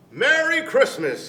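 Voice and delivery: high, loud call across a distance; shouted. A narrator's reading. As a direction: shout it to a boy on the doorstep. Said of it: "he shouted".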